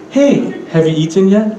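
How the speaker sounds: "yet" rises at the end; English question intonation. Shown as a demonstration. Teacher showing English questions, rising end on "yet". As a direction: let the question climb to a high "yet". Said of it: "the question rises at the end".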